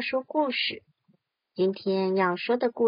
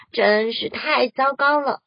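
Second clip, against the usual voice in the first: ordinary delivery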